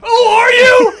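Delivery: very high, loud mock-shriek; over-the-top incredulous squawk; outraged sarcasm. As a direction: screech it in comic indignation. Mock outrage at the Australians' boast.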